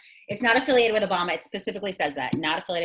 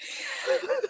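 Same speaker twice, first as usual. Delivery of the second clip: high laugh; 6 dB quieter than usual. Real, not acted. Laughing at friend's joke.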